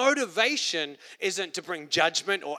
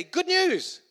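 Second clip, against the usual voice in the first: much higher, sung-out announcement; a light mock angel herald; angel announcing; 12 semitones higher than usual. Angels announcing good news, high and bright. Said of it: "They say".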